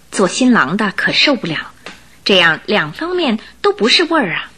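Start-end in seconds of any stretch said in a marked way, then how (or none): none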